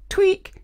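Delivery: loud squeaky tweak noise; high squeaky tweak. A narrator's reading. Sound effect.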